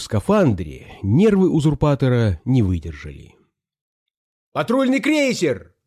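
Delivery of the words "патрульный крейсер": high-pitched call from the crowd; raised voice calling out news; alarmed shout. A narrator's reading. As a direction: cry the warning high, without full force. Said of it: "крикнул кто-то в зале".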